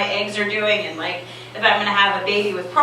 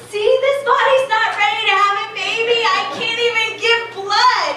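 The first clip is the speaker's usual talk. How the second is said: much higher, fast and frantic; frantic on waking; 11 semitones higher than usual. Retold or imitated. Woman waking after fainting tells the doctor, high and frantic. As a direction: blurt it out in a panic just after coming to. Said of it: "I was like".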